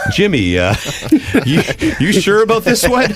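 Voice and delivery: high, hesitant mock voice; doubting caller. Colleague phoning to check his scoop, high imitated doubt. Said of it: "getting calls from you and Drake's going".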